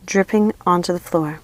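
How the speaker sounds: ordinary delivery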